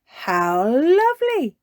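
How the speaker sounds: slow, gentle and pleased. Acted. Mummy Pig thanking Peppa, gently pleased. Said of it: "replied Mummy Pig".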